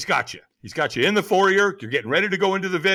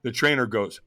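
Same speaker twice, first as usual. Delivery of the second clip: ordinary talk, nothing marked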